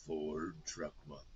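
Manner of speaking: low, slow gravelly truck-ad voice; Will Arnett voice. Shown as a demonstration. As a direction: drop into a deep commercial read. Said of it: "That's my key phrase".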